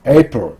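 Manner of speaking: "April" with a strong A, weak ending; stress on first syllable. Shown as a demonstration. Teacher demonstrating April to learners, front stress. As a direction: hit the A of "April", reduce the rest. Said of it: "맨 앞 A에 강세가 있어서".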